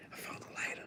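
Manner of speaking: breathless secret whisper of "I found the lighter". Real, not acted. Streamer sneaks a lighter find past his wife, whispering. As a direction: whisper it so she can't hear.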